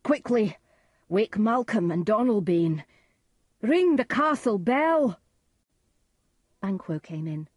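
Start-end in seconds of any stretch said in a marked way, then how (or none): 0.0-5.2 loud, raised alarm; urgent cry. A narrator's reading. Macduff raises the alarm in the castle, urgent cry. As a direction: call out orders to rouse everyone. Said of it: "Macduff cried".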